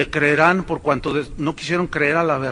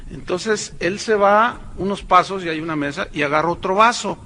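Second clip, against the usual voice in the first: ordinary talk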